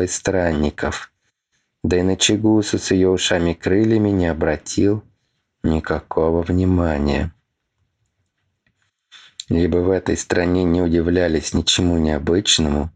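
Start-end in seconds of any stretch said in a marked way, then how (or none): none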